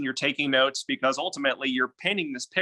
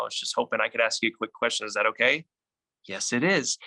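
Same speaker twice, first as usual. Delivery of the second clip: matching calm tone; 2 semitones lower than usual. Shown as a demonstration. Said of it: "you're gonna match their tone".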